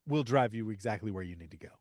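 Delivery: low, soft, flat-out agreeable voice; dropping the voice for a too-helpful stranger; mock deadpan obliging. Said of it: "are like".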